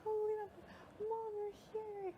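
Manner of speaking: high tearful voice; tearful joy at seeing mom; imitating crying; retold crying. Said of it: "started crying".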